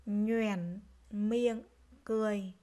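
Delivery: "Nhoẻn miệng cười" word by word, full dipping tone on "Nhoẻn"; careful word reading. Shown as a demonstration. Teacher modelling "Nhoẻn miệng cười" for pupils, separated careful syllables. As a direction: sound out "Nhoẻn" fully, then "miệng", then "cười". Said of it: "Nghe cô đọc lại nhé".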